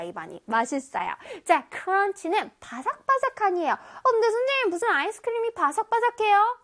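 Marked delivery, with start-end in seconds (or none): 3.7-6.6 high, questioning pupil voice; doubting student. Acted. Pupil asks teacher how ice cream is crunchy, doubting. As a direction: pipe up as a puzzled pupil. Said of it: "이런 친구들도 있는데".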